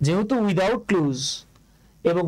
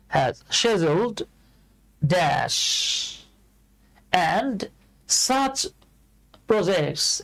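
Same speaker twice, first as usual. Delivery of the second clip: has scheduled drawn out slowly with shedjooled; slow British reading; much slower than usual. Shown as a demonstration. Teacher reads slowly following British pronunciation. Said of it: "আমরা ব্রিটিশটাকে follow করার চেষ্টা করব".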